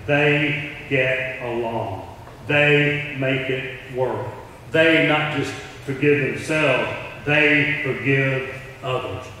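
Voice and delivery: very slow, deliberate words; the world describing the church, slow and deliberate; slow emphatic quote. Acted. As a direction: lay each phrase down slowly. Quoting what the world says.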